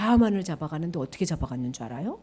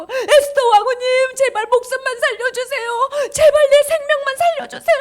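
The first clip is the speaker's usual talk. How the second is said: very high, loud, tearful plea; desperate begging; 10 dB louder than usual. Acted. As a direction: beg hysterically for your life, hammed-up. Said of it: "막 살정사정하는 거예요". (울며 애걸복걸하는 연기)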